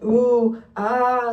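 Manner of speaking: loud, exaggerated oohing; hammed-up uneasy ooh; mock hesitant reaction. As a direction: imitate someone reacting with doubt. Imitates staff's uneasy reaction.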